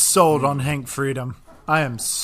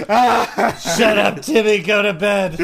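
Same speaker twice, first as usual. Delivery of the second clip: raised-pitch, hammed-up parental yell; mock tearful outburst; 4 semitones higher, 3 dB louder, slower than usual. Pretend parent sending Timmy to bed, mock tearful yelling. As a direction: send the child off in fake upset.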